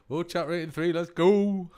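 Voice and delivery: loud, high, thrilled whoop; excited cheer. Real, not acted. Streamer cheering a chat rating, loud excited whoop.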